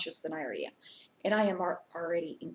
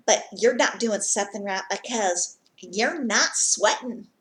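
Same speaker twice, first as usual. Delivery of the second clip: loud, higher-pitched Southern scolding; mock Southern twang; 3 semitones higher, 6 dB louder than usual. Gym-goer telling someone they're not sweating enough, mock Southern twang. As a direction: imitate a pushy gym know-it-all with Southern twang.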